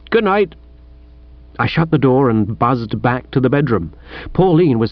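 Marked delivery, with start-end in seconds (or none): 0.1-0.5 higher-pitched answering good night; reply lightly in a higher voice; gentleman's farewell. Acted. Bertie answers the departing sergeant.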